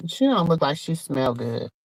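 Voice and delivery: soft, confiding dig; catty aside. Real, not acted. Telling viewers Monette looks unwashed, in a soft catty aside.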